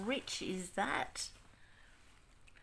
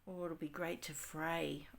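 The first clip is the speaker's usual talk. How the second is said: usual speaking voice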